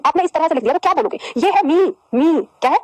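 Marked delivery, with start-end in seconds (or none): 1.7-2.4 मी drawn out slowly; long vowel demo. Shown as a demonstration. Teacher testing the spelling by sound, मी drawn out. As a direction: stretch each मी long. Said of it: "छोटी ई लगाकर देखो".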